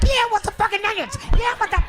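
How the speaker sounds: high, fast shouted answer; hammed-up spouse shouting back; mock yelled reply. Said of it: "She like".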